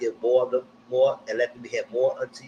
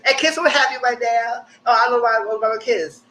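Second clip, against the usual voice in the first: higher, louder mocking imitation; mock eager chatter; 9 semitones higher, 5 dB louder than usual. Mimicking kiss-obsessed contestants' every conversation, mocking. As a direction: exaggerate their silly kiss talk, pitched up.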